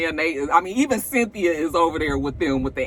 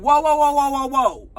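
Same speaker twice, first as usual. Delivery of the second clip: calming shouts; 7 semitones higher, 5 dB louder than usual. Retold or imitated. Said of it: "everybody's like".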